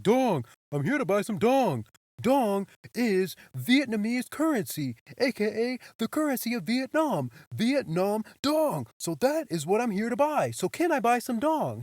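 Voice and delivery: exasperated customer. Retold or imitated. Customer explains dong to the clueless teller, exasperated and loud.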